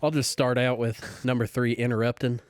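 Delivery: folksy Southern church lady listing sins; Southern accent. Pretend. Closing statement for the podcast game in a Southern accent. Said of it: "You have to do it in a Southern accent".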